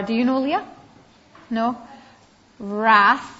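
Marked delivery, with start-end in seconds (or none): 2.6-3.3 "Wrath" said as "rath", with no w sound; "Wrath" read aloud with the w kept silent. Shown as a demonstration. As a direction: say "Wrath" starting straight on the r. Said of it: "but it's a silent w".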